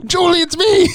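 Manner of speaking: high, put-on earnest plea; hammed-up tragic lover; mock hero voice. Retelling the graveyard scene, the hero calls to Julie in a high mock plea. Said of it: "He's just like".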